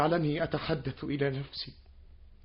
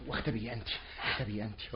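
much softer, low urgent hush; 7 semitones lower, 11 dB quieter, faster than usual. Acted. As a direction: hiss the order to hide. Hiding her from visitor. (همس مستعجل)

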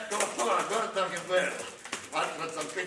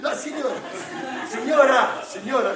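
louder, trying to cut in; calling over her; 4 semitones higher, 7 dB louder than usual. Acted. Santa trying to talk over a shouting mother, loud. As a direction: raise the voice to stop her.

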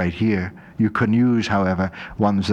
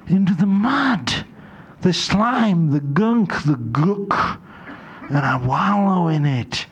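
high, slow and relishing; wallowing relish; 6 semitones higher, slower than usual. Acted. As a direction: savour the words as if sinking into mud. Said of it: "I get into the mud".

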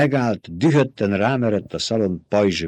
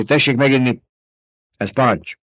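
forceful, raised-voice order; firm command; 4 semitones higher, 4 dB louder than usual. A narrator's reading. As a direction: order them without allowing argument. Ordering him to drink. (határozott parancs)

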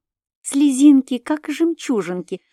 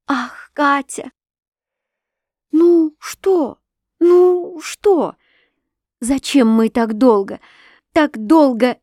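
high, urgent cry of feeling; a touch of overwhelmed emotion, pitched up; impassioned outburst; 5 semitones higher, 4 dB louder, slower than usual. A narrator's reading. Overjoyed reconciliation after long silence. (взволнованно, порывисто)

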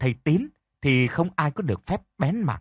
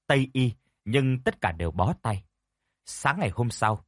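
ordinary delivery, nothing marked

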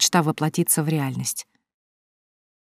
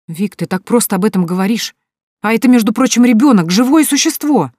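higher, louder burst of anger; flaring up; 6 semitones higher, 7 dB louder than usual. A narrator's reading. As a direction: flare up at her friend. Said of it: "вспылила Соня".

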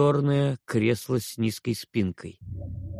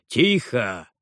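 sharp father's bark; barked command. A narrator's reading. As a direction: bark for silence. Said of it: "гаркнул Гарольд Сингер". (отец гаркнул)

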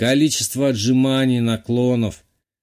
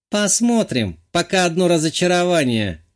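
haughty blonde; 6 semitones higher than usual. A narrator's reading. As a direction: sniff at it with disdain. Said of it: "надменно произнесла гордая блондинка". (надменно, свысока)

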